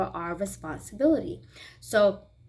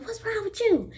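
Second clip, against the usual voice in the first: mom's concern; 11 semitones higher than usual. Retold or imitated. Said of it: "And she was like".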